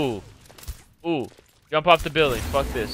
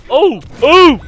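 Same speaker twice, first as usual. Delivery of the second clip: shocked yelps; 10 semitones higher, 11 dB louder than usual. Real, not acted. Reacting to game event.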